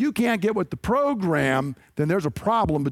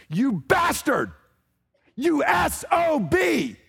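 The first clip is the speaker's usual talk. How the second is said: slow, much higher shout with a rough edge; angry shout; 7 semitones higher, slower than usual. Retold or imitated. Saul shouting his insult at his son Jonathan, a furious shout. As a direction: spit out the insult at full force. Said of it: "because what he said is".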